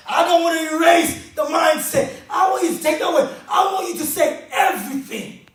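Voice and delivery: full-force preaching. Acted. Motivational speaker drilling the audience on their dreams, shouting sermon. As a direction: hammer each question home to the room. Rallies the crowd.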